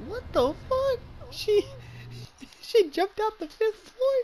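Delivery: high incredulous squeal; shocked disbelief. Real, not acted. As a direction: cry out in laughing disbelief. Reacting to game nurse's confusion.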